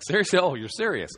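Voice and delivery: high, loud incredulous yelp. Retold or imitated. Pastor hearing granny volunteer, high loud disbelief. Youth minister laughing disbelief retold.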